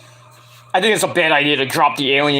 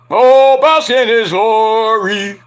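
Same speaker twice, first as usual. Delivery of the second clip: slow, drawn-out, louder wrestler proclamation; grand catchphrase; 4 semitones higher, 6 dB louder, much slower than usual. Pretend. Streamer performs the wrestling catchphrase, slow and grand. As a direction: boom it out like a ring announcer savoring it.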